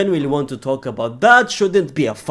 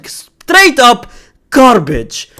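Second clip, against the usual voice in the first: higher, louder scoff; loud disgust; 7 semitones higher, 7 dB louder, much slower than usual. Real, not acted. Fan scoffing at Madrid lineup, loud disgust. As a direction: bark it out in disgust.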